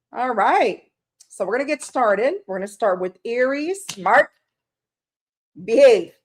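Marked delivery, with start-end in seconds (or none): 4.0-6.1 mock scolding. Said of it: "I'm just kidding".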